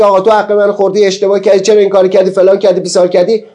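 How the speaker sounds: angry outburst. Retold or imitated. Speaker voicing himself scolding someone who wronged him, heated and fast. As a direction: rattle off grievances like you've had enough.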